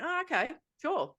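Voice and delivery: high-pitched, lightly put-on acceptance; mock agreeableness. Retells her reply on the phone.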